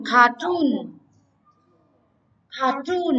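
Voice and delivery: Cartoon with TOON held and lifted; stress on second syllable. Shown as a demonstration. Teacher modelling for the class to repeat, stress on TOON. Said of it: "nó sẽ rơi vào toon".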